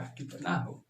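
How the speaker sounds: imitating a student whispering to himself; breathy wondering whisper; a pupil muttering the question in a whisper, puzzled. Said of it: "तो बहुते बच्चा सोचने लगा".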